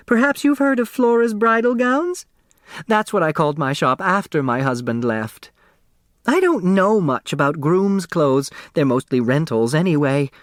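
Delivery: put-on a small, round seamstress selling her old shop; eager dressmaker.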